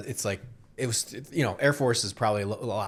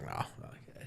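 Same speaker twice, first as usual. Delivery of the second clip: whispered refusal; 16 dB quieter than usual. Retold or imitated. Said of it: "It's like".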